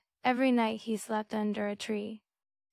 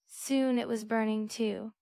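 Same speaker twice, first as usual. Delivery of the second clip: ordinary delivery, nothing marked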